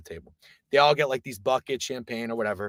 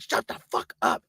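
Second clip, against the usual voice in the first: harsh, voiceless warning; desperate whispered shushing; urgent whisper; 3 dB quieter than usual. Retold or imitated. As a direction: whisper it so the next table can't hear. Shushing friend near star.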